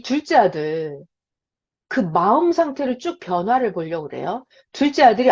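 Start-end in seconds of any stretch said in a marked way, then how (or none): none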